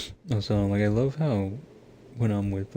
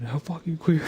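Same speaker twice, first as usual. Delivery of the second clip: higher, louder blurted shout at a monster; retold shout; 7 semitones higher, 3 dB louder than usual. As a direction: yell it out mid-fight as a joke. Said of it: "And I just fucking shouted".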